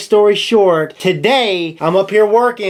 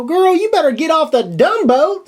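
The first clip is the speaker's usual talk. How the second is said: sassy retort; 11 semitones higher than usual. Retold or imitated. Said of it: "I go".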